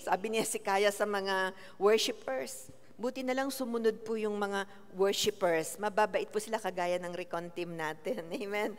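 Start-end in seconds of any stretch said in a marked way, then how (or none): none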